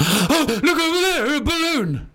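put-on high, squealing excited kid; a child spotting a balloon. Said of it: "like a child".

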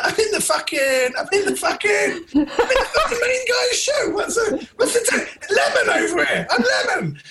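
high-pitched laughing indignation; mock outrage. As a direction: squeal it out in comic disbelief. Comic indignant rant about fame.

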